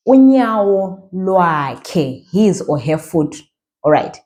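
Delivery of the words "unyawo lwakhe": "unyawo lwakhe" stretched out slowly; teacher drawing out the phrase; slow demo. Shown as a demonstration. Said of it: "slow down sometimes".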